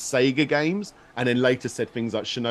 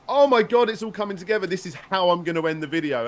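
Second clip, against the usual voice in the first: high excited eureka, easing down; freaking out; 8 semitones higher than usual. Retold or imitated. Guest relives finding his video's ending, excited freak-out. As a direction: burst out with the breakthrough. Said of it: "I just started freaking out".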